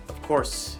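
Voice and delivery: plain reading voice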